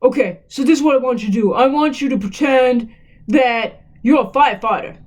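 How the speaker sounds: put-on voice of a phone caller; put-on character voice asking for make-believe; Larry the caller. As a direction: play an odd caller making a request. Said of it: "And then he goes".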